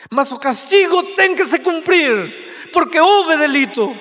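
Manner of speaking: mother preaching justice; solemn loud declaration. Acted. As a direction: proclaim the law with force.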